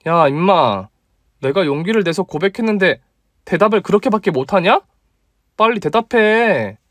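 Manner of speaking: put-on bold young woman insisting; female friend demanding. Female colleague pressing. (높고 다그치는 여자 목소리)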